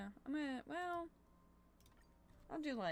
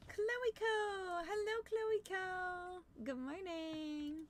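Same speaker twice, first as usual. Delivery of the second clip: high, slow delighted hello; bright sing-song welcome to a viewer; excited greeting; 10 semitones higher, much slower than usual. Real, not acted. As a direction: gasp and greet a friend warmly.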